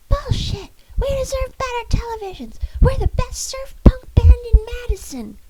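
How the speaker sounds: high, loud tantrum yell; toddler tantrum scream. Acted.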